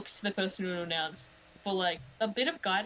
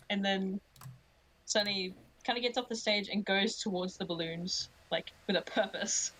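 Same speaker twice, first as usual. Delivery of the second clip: normal talking voice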